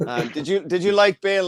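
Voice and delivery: normal talking voice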